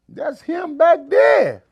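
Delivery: high, loud, slow shout; calling out. Retold or imitated. Al shouting to Boogie that his fan sits in the back, loud slow call. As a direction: stretch it out like calling across the car.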